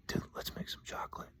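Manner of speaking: very soft prank whisper. Retold or imitated. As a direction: whisper it like a silly secret. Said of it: "I whispered in a grown man's ear".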